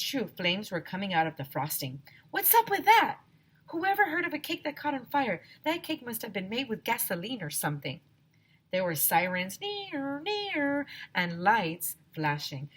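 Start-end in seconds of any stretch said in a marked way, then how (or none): 2.3-8.0 put-on amazed kid voice; incredulous. A narrator's reading. Character reacting in story. 9.6-10.9 high wailing siren sound; mock siren; siren imitation.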